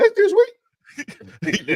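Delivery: very high, drawn-out and slow; mock-excited falsetto repeat; squeaky high excited. Shown as a demonstration. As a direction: stretch That's this week way up high. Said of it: "my voice got high 'cause I was excited".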